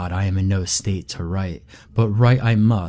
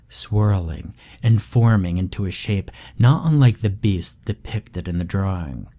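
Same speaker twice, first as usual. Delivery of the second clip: ordinary delivery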